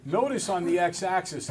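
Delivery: normal talking voice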